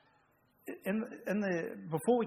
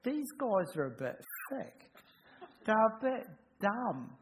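mock thought; 8 semitones higher, slower than usual. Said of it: "I thought".

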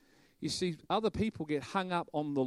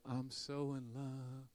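soft, slow, dreamy sigh of a line; mock a sappy lovestruck worshipper, very softly; mock dreamy swoon; 13 dB quieter, slower than usual. Mocking harp-playing worshippers, a soft dreamy swoon. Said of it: "sitting around playing music and harps going".

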